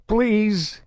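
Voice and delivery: high, desperate plea; begging. A narrator's reading. Prisoner begs Saren for mercy, high and desperate. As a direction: beg for mercy, voice climbing high. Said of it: "he begged".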